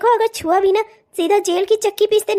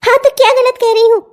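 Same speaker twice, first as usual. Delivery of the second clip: louder defiant retort; angry; 3 semitones higher, 6 dB louder than usual. Acted. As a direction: refuse to back down. Wife shouts back at husband. (पति को चिल्लाकर जवाब)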